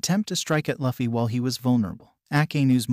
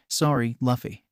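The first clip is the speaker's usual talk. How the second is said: usual speaking voice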